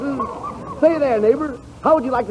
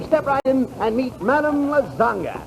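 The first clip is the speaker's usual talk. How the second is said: ordinary talk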